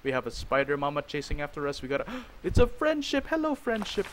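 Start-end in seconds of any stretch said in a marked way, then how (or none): 2.2-4.1 high-pitched delighted squeal of words; gasp, then greet it in a high excited voice; high, thrilled hello. Real, not acted. Gasps and greets a surprise friend.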